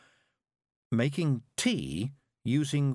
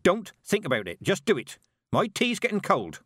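higher, sharp command; brisk snapping; 9 semitones higher, 4 dB louder than usual. A narrator's reading. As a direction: push her on impatiently. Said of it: "Mistress Weatherwax snapped".

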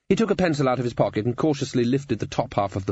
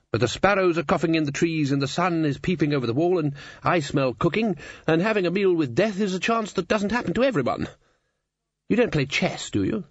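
higher-pitched, expansive chancellor, rolling on cheerfully; hearty old wizard chancellor; 7 semitones higher than usual. Acted. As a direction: chat grandly as the head wizard. Voices Ridcully in the story.

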